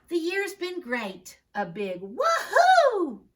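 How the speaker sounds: slow, high, loud woohoo; excited cheer. Acted. Students cheering their teacher in the poem, a big high whoop. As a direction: whoop it out like a celebration.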